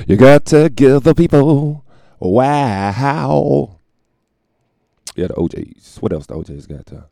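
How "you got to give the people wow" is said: loud, slow, drawn-out showman call; hype. Pretend. To the audience, hammed-up hype announcer. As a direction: belt it like a hype man.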